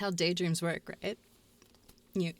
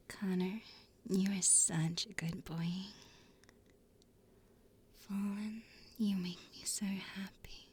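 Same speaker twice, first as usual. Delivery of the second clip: unvoiced whisper of praise; 5 dB quieter than usual. Real, not acted. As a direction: whisper it close to the mic. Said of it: "I can whisper you some names".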